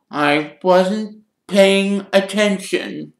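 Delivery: high, drawn-out theatrical whine; dramatic. A narrator's reading. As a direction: stretch each word out dramatically. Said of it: "said Myrtle dramatically".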